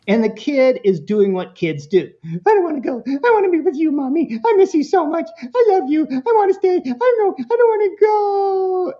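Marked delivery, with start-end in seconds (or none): 2.2-8.9 put-on high, pleading little kid voice, not wanting to leave mommy; whiny child begging. Child at daycare drop-off begging mommy not to leave, high and whiny. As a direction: whimper like a clingy toddler.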